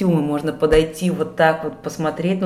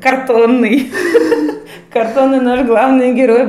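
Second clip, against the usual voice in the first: laughing; 6 semitones higher, 7 dB louder than usual. Real, not acted. Восторг от картонного героя.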